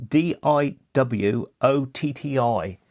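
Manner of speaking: slow letter-by-letter spelling of D I W O T T I; careful dictation of a Cornish word. Shown as a demonstration. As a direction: spell it out slowly, one letter at a time. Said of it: "public is P O B L E K".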